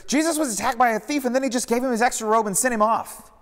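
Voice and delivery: high, loud, all run together in one breath; blurted out. A narrator's reading. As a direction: spill it out before anyone else can. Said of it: "Joanna blurted".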